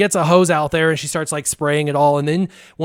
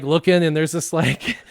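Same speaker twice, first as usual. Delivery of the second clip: usual speaking voice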